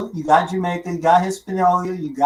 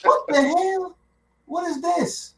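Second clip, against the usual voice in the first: high-pitched, incredulous squawk; mock disbelief; 12 semitones higher, 3 dB quieter, slower than usual. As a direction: react baffled, voice way up high.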